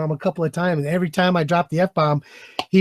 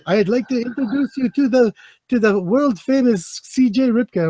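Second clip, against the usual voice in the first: imitating a Pakistani accent; 5 semitones higher than usual. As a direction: mimic the Pakistani host's accent with announcer flair. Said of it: "Did you do the accent".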